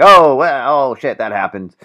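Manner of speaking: high, loud mock gasp of shock; over-the-top fake surprise; mock shocked reaction. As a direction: ham up a stunned viewer. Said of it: "his shock stuff is just kinda like".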